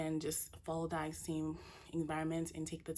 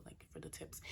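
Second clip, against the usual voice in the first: whispering; 12 dB quieter than usual. Acted. Trailing whispered aside.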